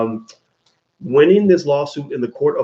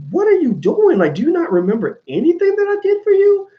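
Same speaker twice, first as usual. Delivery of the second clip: much higher, pleading in disbelief; incredulous plea; 9 semitones higher than usual. Retold or imitated. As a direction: ask as if betrayed and baffled. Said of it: "to where I'm like".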